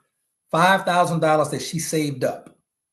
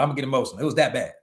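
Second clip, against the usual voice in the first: ordinary talk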